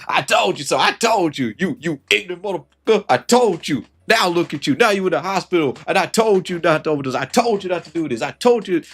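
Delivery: high, loud smug scolding; gloating I told you. Pretend. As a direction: hammer it home like a self-righteous friend. Mock gloating friend in hospital.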